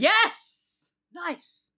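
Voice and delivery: sudden high, loud cheer; excited cheer. Real, not acted. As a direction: cheer it out with glee. Gleeful that the film flopped.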